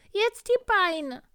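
very high, loud and slow, a pitiful poorly tiger; a hammed-up sick tiger voice, high and drawn out; sick little tiger. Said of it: "sagte der kleine Tiger".

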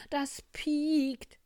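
high, loud warning on a falling end; boastful fox warning. Acted. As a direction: state it ominously as a show-off, stretching PIEKT. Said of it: "spielte sich der Fuchs auf". (hohe, laute Angeberwarnung)

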